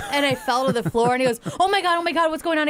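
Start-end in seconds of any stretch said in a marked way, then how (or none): 1.6-2.7 high, urgent alarmed husband; mock panic. Wife retelling husband's alarm at her fall, panicked. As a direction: imitate someone panicking over a fallen partner.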